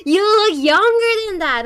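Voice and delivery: high, loud, drawn-out mock disbelief. Girl refuses to believe the streamer's age, loudly incredulous. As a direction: protest it loudly as the astonished girl.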